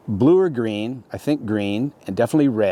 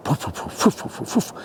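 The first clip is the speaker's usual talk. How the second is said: imitated helicopter whoof; 7 semitones higher than usual. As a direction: puff out the thumps in threes, then stop. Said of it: "but in threes".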